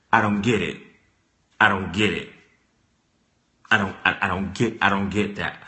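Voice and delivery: low, quiet impression of Mister Willie; low mock Mister Willie. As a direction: mutter it low and hurt. Voicing Mister Willie, low and soft.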